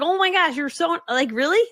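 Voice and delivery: high-pitched, flattered disbelief; delighted shock. Retold or imitated. Replying to an adoring fan, pleasantly shocked. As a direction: react stunned and touched, rising at the end. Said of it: "so shocked, and it was so nice".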